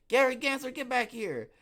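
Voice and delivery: high, loud summons; mock calling out. Host hollering after Gary Gensler, mock-stern shout. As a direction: yell it like a comic-book scolding. Calling out a comic-book villain name.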